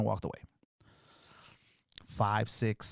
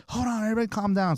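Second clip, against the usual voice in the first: imitated urgent mom; 7 semitones higher, faster than usual. Imitates mom stopping the fight.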